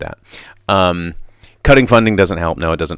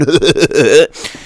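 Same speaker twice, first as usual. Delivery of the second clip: mocking laugh; 7 semitones higher, 5 dB louder than usual. Mocking filming bystanders.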